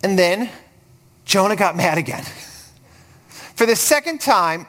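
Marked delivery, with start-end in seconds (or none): none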